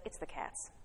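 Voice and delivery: low, very soft smug husband; imitating a smirking husband, dropped low and quiet; infuriating smirk. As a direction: mutter it smugly, almost whispered. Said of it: "He smirked infuriatingly at me and said".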